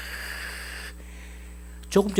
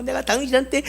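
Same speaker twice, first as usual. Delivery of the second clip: angry rant; 7 semitones higher, 4 dB quieter than usual. Acted. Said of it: "막 욕을 퍼부어대도 막 고래고래".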